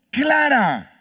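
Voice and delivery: high, ringing shout; loud proclamation. Shown as a demonstration. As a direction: call it out at full voice. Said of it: "A viva voz".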